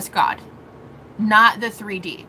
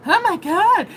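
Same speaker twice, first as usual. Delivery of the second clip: mom's oh my god; 9 semitones higher than usual. Retold or imitated. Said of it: "she's just like".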